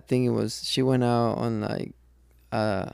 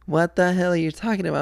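imitated higher, quick comeback; retold indignant denial; 6 semitones higher, faster than usual. Husband imitates wife questioned about the condom, indignant. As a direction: fire back indignantly, imitating her.

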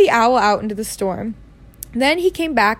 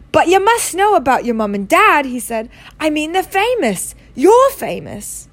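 astonished Hagrid; 5 semitones higher, 4 dB louder than usual. A narrator's reading.

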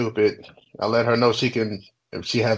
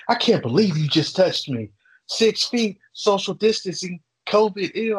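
higher-pitched, huffy imitation of an indignant customer; mock pissy; 7 semitones higher than usual. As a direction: mimic someone getting all pissy. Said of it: "gets all pissy and was like".

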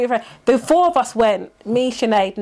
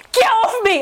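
1 annoyed friend; 9 semitones higher than usual. Retold or imitated. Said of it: "Nat's like".

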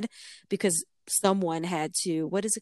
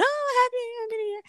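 high-pitched imitation of party revellers; squealed mock celebration; party cheer. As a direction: mimic a crowd's New Year whoop. Party guests cheering New Year.

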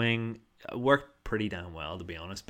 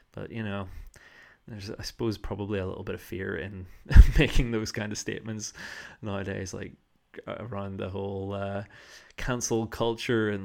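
ordinary delivery

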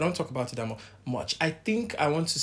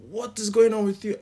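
retold alarmed shout; 9 semitones higher, 6 dB louder than usual. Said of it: "they were shouting".

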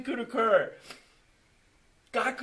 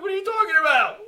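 baffled outburst; 8 semitones higher, 10 dB louder, faster than usual. Retold or imitated. Retold baffled reply.

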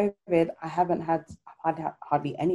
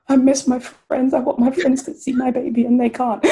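hormonal wail; 8 semitones higher, 6 dB louder than usual. Retold or imitated. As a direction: plead it like a new mum missing her friends.

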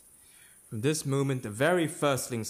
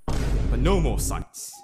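slow and loud, final; firm refusal; 6 dB louder, slower than usual. Acted. Macbeth to himself, slow loud refusal of visions. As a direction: shut the door on the visions.

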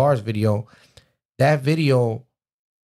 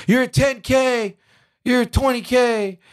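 high, drawn-out holler; slow, high-pitched announcing call; calling out updates; 14 semitones higher, slower than usual. Retold or imitated. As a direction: imitate a friend shouting from upstairs. Friend calls updates from upstairs.

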